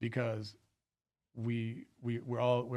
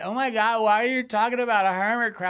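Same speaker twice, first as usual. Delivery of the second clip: high, louder put-on voice of a drunk heckler; mocking a drunk woman; 8 semitones higher, 7 dB louder than usual. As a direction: ham up a drunk woman squealing at the comic. Comic imitates a drunk woman heckler.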